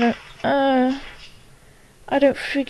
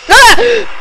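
short, high, loud shriek; startled scream; 11 semitones higher, 12 dB louder than usual. Real, not acted. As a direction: scream at a sudden jump scare. Jump scare in the video.

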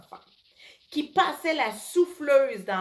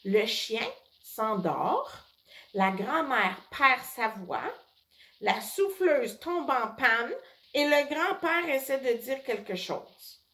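ordinary delivery

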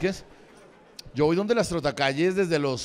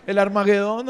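mock doom; 6 semitones higher, 8 dB louder than usual. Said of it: "dijo un hijo de puta".

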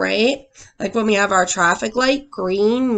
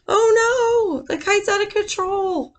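much higher worried cry; alarmed; 10 semitones higher than usual. A narrator's reading. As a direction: fret for the runaway kite. Dramatic story moment.

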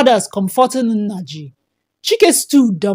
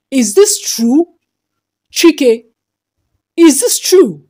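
angry demand, rising higher and louder; demanding in anger; 4 semitones higher, 4 dB louder, slower than usual. A narrator's reading. Father confronts his son before the guests, angrily and loud. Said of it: "his voice trembling with anger".

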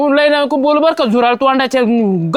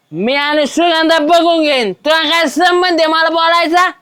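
put-on drunken angry shout at his friend; 4 semitones higher than usual. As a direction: shout your grievance at the empty street. Drunk man yelling at absent friend.

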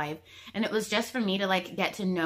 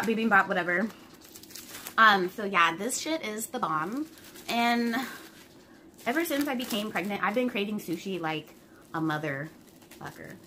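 normal talking voice

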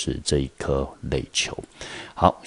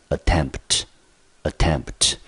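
slow teacher demo, punch the second syllable of attempt; stress on second syllable; much slower than usual. Shown as a demonstration. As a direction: attempt said slowly, stress on -tempt. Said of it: "重音节落在第二个音节". (重音在第二个音节，慢读attempt)

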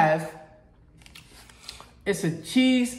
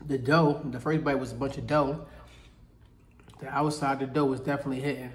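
chewing while talking; talking between bites; mouth full; 5 semitones lower, 5 dB quieter than usual. Real, not acted. Seen 0: Holds bitten pie at mouth, lips pressed and chewing between words.

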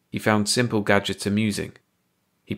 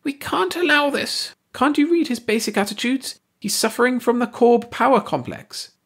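fearful whine. A narrator's reading. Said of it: "he heard Ursi whine fearfully".

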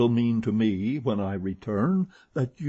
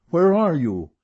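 loud, raised call to someone unseen; crying out; 7 semitones higher, 8 dB louder than usual. A narrator's reading. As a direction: call out urgently, pitched up. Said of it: "I cried".

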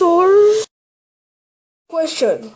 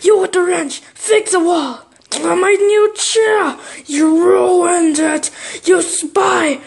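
mad boss; 4 semitones higher than usual. Acted. Boss blames worker for ruined plans, angry shouting. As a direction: shout out each accusation, stretching the words. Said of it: "when you're mad at me".

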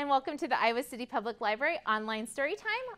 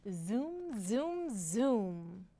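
soft, swooping zooms; zooming sound effect; 6 dB quieter, much slower than usual. A narrator's reading. Narrator imitating the kite flying off, zooming softly. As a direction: let each zoom glide up and down like a kite.

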